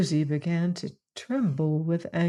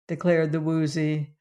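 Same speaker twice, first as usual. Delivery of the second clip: usual speaking voice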